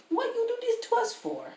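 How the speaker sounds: high-pitched mock complaint; mock outcry. Game master voicing the accusers of Baldur's Gate, high mock outcry. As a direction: whine it way up high as an imitation.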